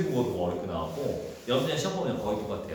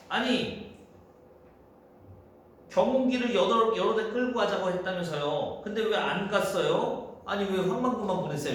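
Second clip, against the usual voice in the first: accusatory questioning; 7 semitones higher than usual. Acted. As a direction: press them with pointed, complaining questions. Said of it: "민 씨가 막 따지는 거야".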